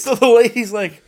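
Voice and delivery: high and loud, laughing through it; giggling out the words. Real, not acted. Recounting the psychic to a friend, laughing hard.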